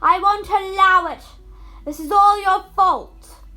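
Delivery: high, loud raging shout. Acted. Stepmother shouting she won't allow the match. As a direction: rage at everyone. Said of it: "shouted their mother".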